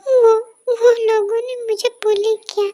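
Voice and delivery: sobbing confession. Acted. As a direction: choke out the words between sobs. Boy confesses through tears.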